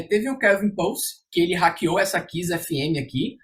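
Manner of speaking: ordinary talk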